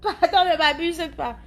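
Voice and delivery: high, laughing outburst. Real, not acted. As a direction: crack up while talking. Amused by a viewer comment.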